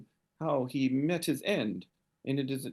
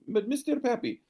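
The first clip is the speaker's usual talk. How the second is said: higher woman's voice, grave; high woman's voice turned earnest about her brother; serious lady; 3 semitones higher than usual. Acted. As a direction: turn dead serious and confide. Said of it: "She says".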